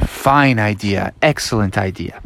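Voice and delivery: measured agreement. Acted. Froggy accepts mom's suggestion.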